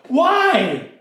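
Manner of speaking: high-pitched scandalized cry of why are we wasting this; mock outraged yell. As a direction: play Judas squawking in outrage. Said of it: "he just kinda yells out".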